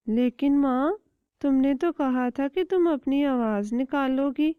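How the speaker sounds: put-on small child chick voice; little chick. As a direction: ask mother with childish curiosity. Chick doubts.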